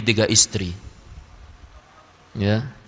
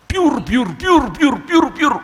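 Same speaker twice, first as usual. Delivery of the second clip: high, loud splashing-water sounds, rising with each pair; shower splash noise; 12 semitones higher, 4 dB louder, slower than usual. Acted. Preacher acting out the husband bathing, loud water noises. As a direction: shout piur piur like water poured over you.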